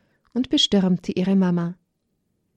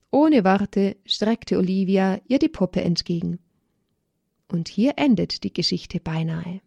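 plain reading voice

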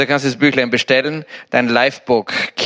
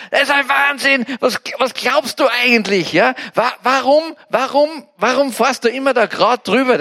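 high-pitched scolding, much higher than his own voice; scolding friend; 8 semitones higher than usual. Retold or imitated. Friend scolding him for driving over the crossing, indignant high-pitched scolding. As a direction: act out a friend's indignant rant from the passenger seat. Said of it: "schimpft mich und sagt".

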